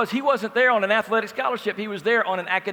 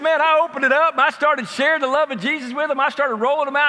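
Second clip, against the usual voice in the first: raised excited retelling; 5 semitones higher, 5 dB louder than usual. Retold or imitated. [name] retelling his preaching.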